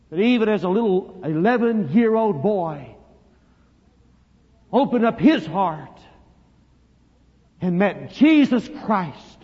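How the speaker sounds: loud, high, slow proclamation; stretched, triumphant pulpit cry; full-force preaching. Real, not acted. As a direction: ring out each phrase to the congregation.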